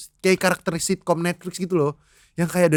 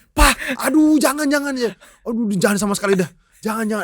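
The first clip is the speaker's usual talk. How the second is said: high, urgent mock plea; hammed-up panicky protest; alarmed pleading; 6 semitones higher than usual. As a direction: beg dad to stop, pitched way up. Pleading with father to stop.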